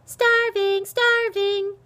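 high, loud mocking shriek; shrill jeering echo. A narrator's reading. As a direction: shriek it as a little brother's taunt. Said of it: "shrieked little Arnie".

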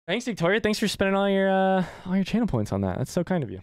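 high, bright gushing thanks; excited thanks. Real, not acted. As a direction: gush with thanks. Thanking donor in raised voice.

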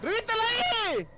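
long high yell of reviéntale; drawn-out shout. Real, not acted. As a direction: stretch the shout out slowly. Urging teammate during fight.

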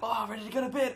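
mock tired viewer. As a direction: whine it like a drowsy fan. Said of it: "Sorry if you're like".

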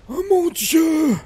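high, slow, drawn-out scream; terrified shout. Real, not acted. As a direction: cry out in sheer terror. Sudden danger in game.

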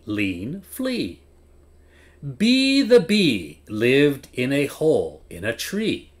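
lively read-aloud, pitch swinging up and down; expressive story reading. A narrator's reading. Teacher reading to young learners, expressive and sing-song. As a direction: read it like a teacher showing children how not to sound like a robot.

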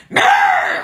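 loud, high comic yowl; mock customer yowl. Customer calling near break room, mock yowl. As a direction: blurt out a nagging noise.

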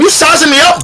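high-pitched, heated challenge; retold challenge. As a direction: confront him as if about to swing. Retelling his challenge before punching.

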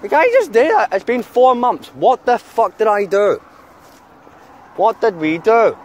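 loud, high, furious outburst; venting real rage, then slowing on the last question; real anger. Real, not acted. Vlogger ranting to his mate about being banned, furious and loud. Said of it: "'Cause I'm about to fucking punch something".

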